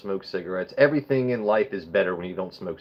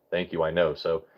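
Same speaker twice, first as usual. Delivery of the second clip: normal talking voice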